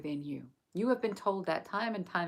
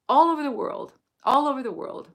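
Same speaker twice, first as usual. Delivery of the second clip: delighted; 7 semitones higher, 8 dB louder than usual. Real, not acted. Marvelling at global audience.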